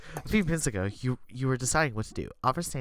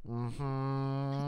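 ordinary delivery

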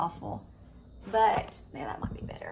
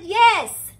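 high, very loud burst; emphatic, urgent re-enacted cry; retold frantic shout; 10 semitones higher, 13 dB louder than usual. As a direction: shout it back in a panic. Retold emphatic answer in panic.

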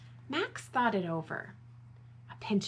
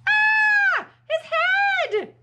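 very high, loud shriek; hammed-up storybook terror; horrified scream; 12 dB louder, slower than usual. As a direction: scream at a dreadful find. Bibi panics at the sight.

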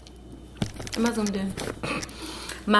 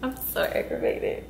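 exasperated whisper; 4 semitones higher, 7 dB quieter than usual. Real, not acted. Frustrated, whispered to herself.

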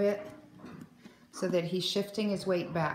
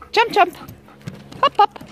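excited cue; 14 semitones higher, 10 dB louder than usual. Real, not acted. Excited dog cue calls.

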